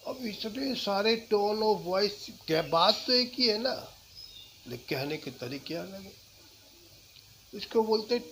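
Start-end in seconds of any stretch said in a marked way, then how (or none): none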